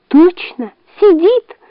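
high, agitated fox voice; insist, voice rising; excited fox. A narrator's reading. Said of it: "взволнованно повторила лиса".

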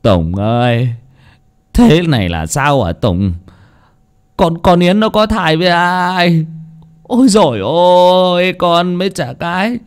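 slow, tearful lament; a father near tears, voice cracking; slow mournful sob-talk; whimpering. Acted. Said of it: "Ông Vui mếu máo".

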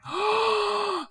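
sharp, high gasp of delight; a real, high, thrilled intake of breath. Real, not acted. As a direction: gasp as if struck by a brilliant idea. Hearing the Red Herring joke.

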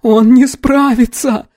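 high, drawn-out despairing moan. A narrator's reading. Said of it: "простонала девушка".

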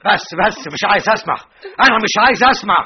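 loud, high shouting; angry refusal. Acted. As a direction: drown her out. Refuses to hear. (صراخ رافض)

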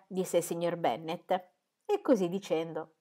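usual speaking voice

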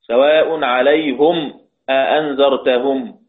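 slow recital, عليهم as "alayhum"; Hamza's recitation. Shown as a demonstration. Teacher quoting a verse in Hamza's reading, slow, عليهم as "alayhum". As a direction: recite slowly with "-hum" ending عليهم. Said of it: "يقرأ حمزة".

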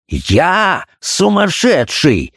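slow, high, raging exclamation; furious cry. A narrator's reading. Doctor rages at his wife, slow furious cry. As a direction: draw each word out in rising fury. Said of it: "закричал он".

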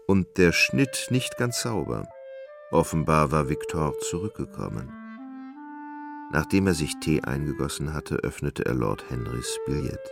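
ordinary talk, nothing marked

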